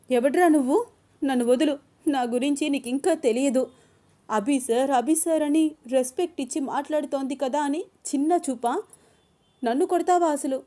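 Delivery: high, loud shouting; woman yelling at the man who hit her; furious struggling. Acted. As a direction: wrestle free while yelling at him. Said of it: "అని గింజుకుంటోంది అను".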